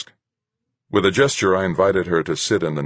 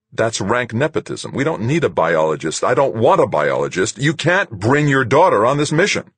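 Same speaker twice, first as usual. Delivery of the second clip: climbing higher and louder into an angry protest; mounting outrage; 8 semitones higher, 6 dB louder than usual. A narrator's reading. Owner protests the captain bringing her daughter, rising angry protest. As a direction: let the anger build to the last line.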